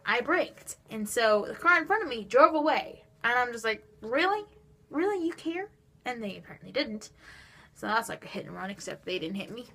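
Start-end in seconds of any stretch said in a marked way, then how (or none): 4.0-5.7 high disbelieving "Really? Really?"; outraged disbelief at the driver; incredulous. Retold or imitated. To the driver who fled, high incredulous disbelief.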